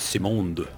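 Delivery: Simonde said as if French, "see-MOND"; French-style name. Shown as a demonstration. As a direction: give Simonde a French ending, stress on the second half. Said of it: "I thought they were French".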